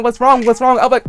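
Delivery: ordinary delivery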